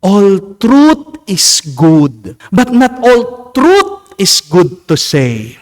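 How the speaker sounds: slow, deliberate proverb, "truth" stressed; slow quoted saying. Shown as a demonstration. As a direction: lay the proverb down word by word. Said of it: "Ang kasabihan nga".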